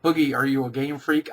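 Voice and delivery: ordinary delivery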